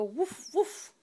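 little high woofs; playful puppy imitation; puppy bark. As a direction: woof like a puppy. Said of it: "Puppy go".

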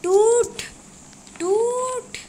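high, drawn-out shouts; shouted urging. Real, not acted. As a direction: yell it long and high, willing it to break. Urging a crystal to break. (ऊँची, खिंची हुई चीख)

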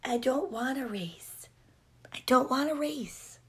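very soft, timid refusal; shy. A narrator's reading. Trevor declining Pig's race, shy and soft. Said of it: "Trevor said shyly".

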